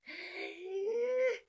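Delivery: high, crying gasp of delight; swooning gasp. Real, not acted. Reader reacting to the final kiss, swooning gasp. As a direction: gasp like your heart just broke open.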